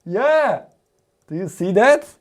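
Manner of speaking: thrilled yelp of success; excited. Real, not acted. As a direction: cheer as the light finally works. LED finally lights up.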